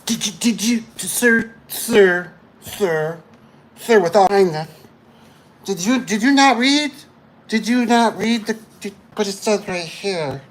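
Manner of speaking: high, stammering, insistent politeness; mock exasperation. Installer pressing sir about not reading, mock exasperation. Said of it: "And you go".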